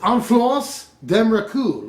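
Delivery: slow, put-on French pronunciation; fake French accent. YouTuber reading a French card name to viewers, fake French accent. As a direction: draw it out in a hammy French accent. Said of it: "sorry about my fake French accent".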